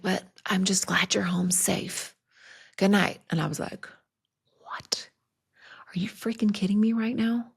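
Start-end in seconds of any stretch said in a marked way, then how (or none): 0.0-3.2 slow, half-whispered imitation of mom; gently relieved mom. Retelling mom's gentle reply. 4.6-7.5 hushed, breathy incredulous reaction; half-whispered indignant disbelief; stunned disbelief. Retold or imitated. Teen's stunned reaction retold.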